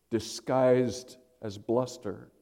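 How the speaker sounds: usual speaking voice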